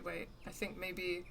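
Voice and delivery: normal talking voice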